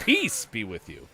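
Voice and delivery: Peace punched loud, slow; stress on peace. Shown as a demonstration. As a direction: hit the word Peace hard. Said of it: "emphasis on each syllable".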